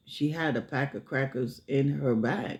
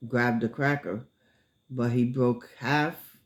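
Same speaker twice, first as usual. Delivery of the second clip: normal talking voice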